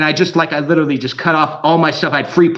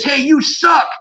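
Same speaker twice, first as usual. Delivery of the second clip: higher-pitched, held-back stadium holler; mock heckling yell; 8 semitones higher, slower than usual. Shown as a demonstration. Fan to player at outdoor stadium, mock heckling. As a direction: call it out like a heckler, without full volume. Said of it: "I could go like this".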